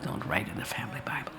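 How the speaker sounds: whispered warning, barely voiced; hushed whisper. Whispering to the audience the family rule about the Bible, hushed. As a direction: whisper it like a forbidden secret.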